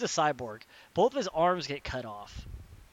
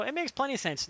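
high-pitched imitation of fans; mock their protest, pitched up; mocking defensive fans; 8 semitones higher than usual. Metal Gear people defend the story, mocking high-pitched retort.

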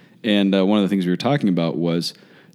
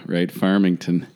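usual speaking voice

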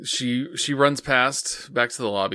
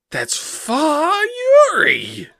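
surprised recognition; 8 dB louder, much slower than usual. Retold or imitated. Character recognizes Fa.

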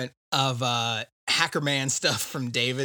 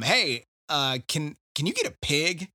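lightly voiced favor-asker, rising higher; Hickman's voice; 6 semitones higher, 4 dB louder than usual. Hickman asking David for a pig, lightly acted. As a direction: build from hesitant to pushy. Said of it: "Hickman mentions to David".

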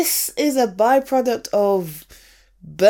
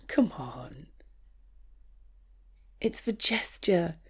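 unvoiced, tender reassurance; soft whisper; 6 semitones lower, 9 dB quieter than usual. Consoling a disappointed partner over burnt dinner, whispered. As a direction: whisper it close to comfort.

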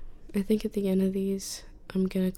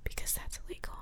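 hushed late-night whisper; 12 dB quieter than usual. Real, not acted. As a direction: keep it all in a breathy whisper. Whispered podcast throughout.

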